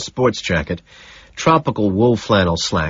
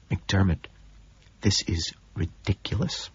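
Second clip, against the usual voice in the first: hushed and much softer; whisper; 9 dB quieter than usual. A narrator's reading. Narrator appeals privately to colleague at the table, whispering. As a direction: drop the volume to an urgent aside. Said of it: "I whisper".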